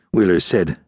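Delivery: ordinary delivery, nothing marked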